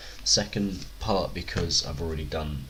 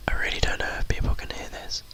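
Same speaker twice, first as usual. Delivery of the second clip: mock ASMR whisper, a bit loud; 14 semitones higher, 4 dB louder than usual. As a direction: do a jokey ASMR intro, whispered. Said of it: "A S M R popcorn".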